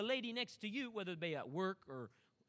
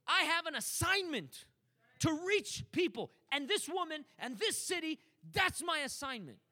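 higher and louder, building preacher's cry; full-force preaching; 5 semitones higher, 4 dB louder than usual. Acted. Preacher urging the church to live for others, full force. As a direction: drive it home to the congregation, slowing on assignment.

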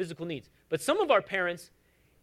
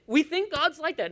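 usual speaking voice

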